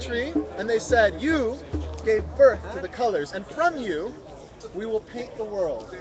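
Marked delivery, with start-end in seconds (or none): none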